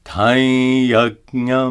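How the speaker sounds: Taiyajnam chanted with a nasalized ya; Sanskrit example. Shown as a demonstration. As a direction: sing Taiyajnam with the ya through the nose. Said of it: "For example".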